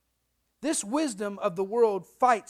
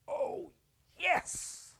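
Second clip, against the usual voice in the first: put-on thrilled teen; 9 semitones higher than usual. Teen thrilled at getting license.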